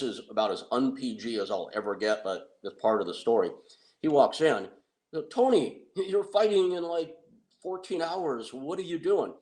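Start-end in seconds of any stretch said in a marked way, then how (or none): 5.3-9.3 incredulous outcry. Retold or imitated. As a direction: call out in shocked disbelief. Friends confront Tony at 3 AM.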